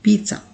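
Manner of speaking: पिज़्ज़ा as "pit-sa" with ts; correct pronunciation. Shown as a demonstration. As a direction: repeat पिज़्ज़ा with a ts sound. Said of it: "मैंने आपको बता दिया". (पिज़्ज़ा का सही उच्चारण)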